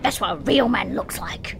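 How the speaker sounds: a breathless whisper to her husband; whispered aside. Acted. Wife whispering to Steve while pointing at Obadiah. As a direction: hiss it admiringly behind your hand. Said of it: "she's like".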